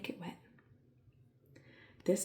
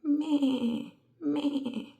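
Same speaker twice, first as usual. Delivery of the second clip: loud, high bleat; goat bleat; 8 semitones higher, 6 dB louder than usual. Shown as a demonstration. Teacher showing the goat's call, loud bleat. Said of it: "They say".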